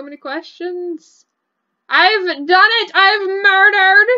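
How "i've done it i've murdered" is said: slow, loud hammed-up villain triumph; mock villain gloat. Player crowing over her Sim's murder, mock-villain gloat.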